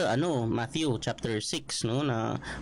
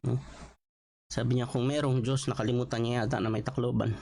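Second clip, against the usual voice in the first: normal talking voice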